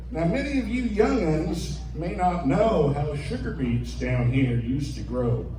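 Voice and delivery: ordinary delivery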